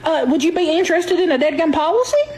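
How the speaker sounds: sweet southern lady. Pretend. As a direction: coo at the caller like a sweet auntie. Fake insurance lady keeps selling.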